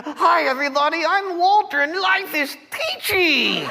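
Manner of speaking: high, sing-song fake cheer; hammed-up chirpy greeting; mock cheerful. Grumpy puppet pretending to greet the crowd cheerfully, high and sing-song. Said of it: "coming out of here in a good mood".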